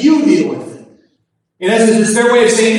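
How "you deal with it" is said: emphatic You, handing it all back; stress on YOU. Shown as a demonstration. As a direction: hit the word You hard. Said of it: "the you there is emphatic".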